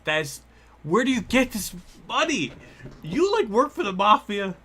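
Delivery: high, loud incredulous questions; can't believe the amount; real shocked rapid questions; stunned disbelief. Real, not acted.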